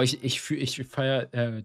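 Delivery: ordinary talk, nothing marked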